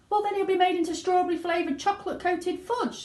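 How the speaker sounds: high, frantic scream; screaming mother. A narrator's reading. Mother screaming at Wonka, high. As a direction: shriek it in horror. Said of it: "screamed Missus Gloop".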